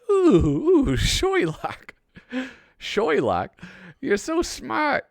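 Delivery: high, slow, dopey admiring voice; goofy high sidekick voice. Retold or imitated. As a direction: play the dim sidekick gushing. Voicing goofy Watson, high pitched.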